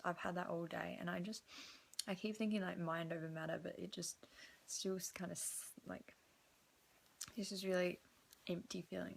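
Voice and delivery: quiet, heavy-hearted late talk; subdued. Real, not acted. Emotional, empty chest, late night.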